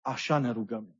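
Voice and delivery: quietly, as a prayer is said; hushed reverent murmur. Retold or imitated.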